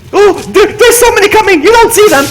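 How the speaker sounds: high, loud, urgent alarm; panicked warning. Real, not acted. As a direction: raise the alarm at a sudden swarm. Zombies swarming the player.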